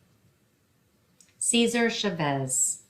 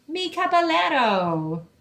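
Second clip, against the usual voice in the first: higher, louder admiring mom voice; Mommy crying out; 10 semitones higher, 5 dB louder than usual. Acted. Mother admiring her dressed-up husband, high delighted exclamation. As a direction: gush over her dashing husband. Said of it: "Mommy cries".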